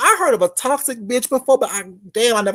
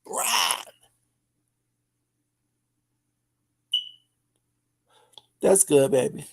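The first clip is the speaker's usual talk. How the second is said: whispering; 6 semitones lower, 6 dB quieter than usual. Acted. Whispered aside.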